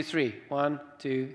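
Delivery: normal talking voice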